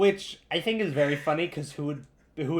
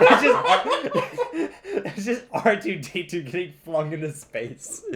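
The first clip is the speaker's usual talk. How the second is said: laughing; 4 semitones higher, 5 dB louder, slower than usual. Real, not acted. Laughing while explaining.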